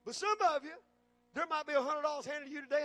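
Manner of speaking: ordinary delivery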